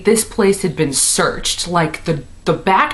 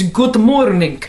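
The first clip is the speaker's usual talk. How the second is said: put-on Russian accent; 3 semitones higher, slower than usual.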